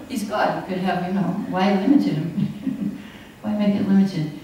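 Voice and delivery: usual speaking voice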